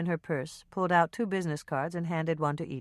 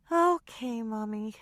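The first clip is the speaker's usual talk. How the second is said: put-on high child's voice, sighing and giving in; resigned little girl; 7 semitones higher, slower than usual. A narrator's reading. Daughter sent off to eat alone, resigned child's sigh. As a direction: give in with a sulky child sigh. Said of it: "with a resigned sigh".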